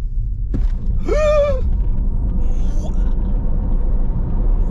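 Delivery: sharp loud gasp of shock. Real, not acted. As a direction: gasp as the car launches. First launch in plaid mode. (souffle coupé de surprise)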